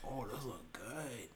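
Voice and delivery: soft, drawn-out appreciation; hushed, lingering approval; low-key admiring. Retold or imitated. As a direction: say it slowly, almost to yourself. Reacting to brownies in his story.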